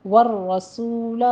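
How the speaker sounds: والرسول slowly with a clean s, no th; tajweed demo. Shown as a demonstration. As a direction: keep the seen of والرسول sharp. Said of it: "سین کو ساتھ نہیں بنانا".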